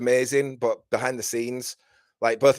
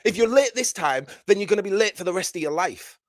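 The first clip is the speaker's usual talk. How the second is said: loud, high-pitched scolding; mean telling-off; 8 semitones higher, 5 dB louder than usual. Pretend. Root berating Kev for being late, angry and domineering. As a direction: tear into the latecomer like a tyrant. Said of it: "He's really mean. He's a total slave driver".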